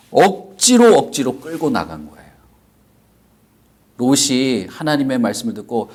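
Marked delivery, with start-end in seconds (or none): 0.1-1.0 억지로 punched high and loud; forced emphasis. Acted. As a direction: hit 억지로 hard and high. Dragged out by force. (억지로를 높고 세게 강조)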